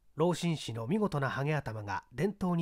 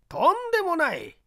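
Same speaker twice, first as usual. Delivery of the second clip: put-on old gentleman; 11 semitones higher, 5 dB louder than usual. 堀見は手を振って.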